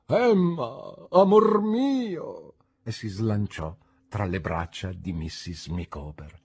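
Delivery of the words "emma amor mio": loud, high cry of love, drawn out; swooning, stretched-out outcry; impassioned exclamation. A narrator's reading. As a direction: call out to your beloved theatrically. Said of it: "mister Micawber esclamò".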